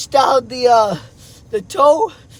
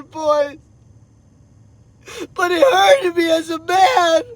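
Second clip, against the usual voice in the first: real crying; 3 semitones higher than usual. Real, not acted. Father telling his boys how hurt he was, crying. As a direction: plead through real sobs, pitch climbing. Father cries over failing to provide.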